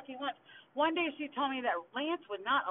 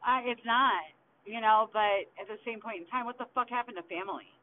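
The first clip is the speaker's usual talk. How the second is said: ordinary delivery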